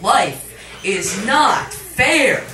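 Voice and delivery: slow, loud, drawn-out outburst; imagined scream. Pretend. Narrator imagining screaming at crying children, slow loud outburst. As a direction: hurl each word at the crying children. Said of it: "urge to scream at them".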